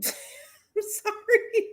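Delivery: giggling through a sorry, high and slow; sorry said through laughter; laughing apology. Real, not acted. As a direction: apologize while still laughing. Laughing apology after outburst.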